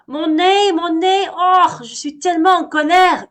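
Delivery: very high, very loud angry howl; furious bear yelling. Acted. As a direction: howl about the stung nose. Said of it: "hurle Monsieur Ours".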